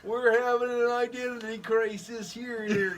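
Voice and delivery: high, loud, slow mock-Canadian drawl. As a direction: put on a hammed-up Canadian accent. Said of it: "And they're like".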